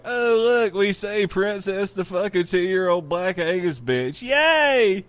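high, loud mock cheer building to a whoop; mock excitement. Host voicing diners spotting Princess, hammed-up excitement. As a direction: cheer like a gleeful kid at the sight. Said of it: "while they're like".

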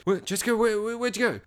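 high, stammered calling; imitating a man in a panic; mock frantic searching. Acts out man searching for girlfriend.

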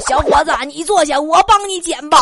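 loud, hammed-up grandpa voice; old man. Old man on the bus to a young man, loud comic grandpa voice. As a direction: boom it out as a pushy old man. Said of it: "旁边一个大爷一把把我拉到他座位上了".

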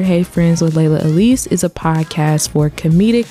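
usual speaking voice

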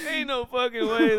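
crying with laughter; wheezing laugh through words. Real, not acted. Cracking up at answer.

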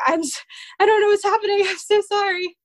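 high, teary, half-laughing apology; imitating herself sobbing at a movie; mock teary apology. Recalling crying at movies, imitates a high teary apology. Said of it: "I would literally start sobbing".

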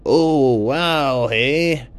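put-on a polite, flustered lost Canadian goose, Canadian accent; lost Canadian goose explains himself to the toucan, Canadian accent. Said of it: "the Canadian goose, said".

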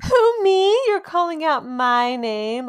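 high, loud, over-the-top "who, me?"; hammed-up teenage flattered disbelief; high school girl. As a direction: play the attention-starved high schooler, big and high. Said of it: "I was that girl in high school".

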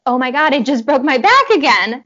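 loud, high panicked blurt, retold; reliving the fear of a broken back; panicked cry. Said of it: "I was panicking".

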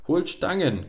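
high, loud breathless command; panting order. A narrator's reading. Miller orders the farmhands, panting urgent shout. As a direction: gasp out the order. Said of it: "keuchte der Müller".

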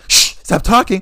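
loud mock shush; mock the date hushing the dog. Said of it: "Every time the dog would bark".

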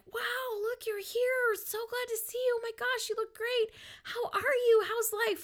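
very high, gushing mock welcome; imitating delighted people at a reunion; excited greeting. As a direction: squeal hello like long-lost friends. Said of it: "everyone was excited to see me".

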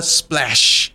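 ordinary talk, nothing marked

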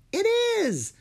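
squeaky high little fairy. A narrator's reading. Fairy answering Drake, very high and light. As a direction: chirp it like a tiny fairy.

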